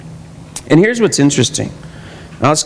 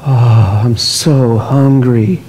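slow, moaning hungry complaint; hammed-up faint hunger; mock weary faster; 4 semitones lower, much slower than usual. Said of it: "when the hypocrites would fast".

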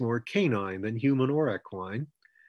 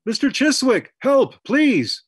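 high, loud cry for help; loud shout; 13 semitones higher, 10 dB louder than usual. A narrator's reading. Rollo calls Mister Chiswick for help, loud high shout. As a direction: shout out pleadingly, pitched way up. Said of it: "Rollo shouted amazingly loud".

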